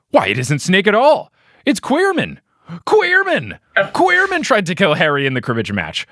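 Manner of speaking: each sentence opens on a high exclamation, then falls; hammed-up astonished narrator; repeated surprise. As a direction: hit the name high with fresh mock shock each time. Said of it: "the inflection in which he starts every sentence with that repeating surprise".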